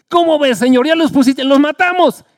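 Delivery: eager pleading. Acted. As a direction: push hard for permission.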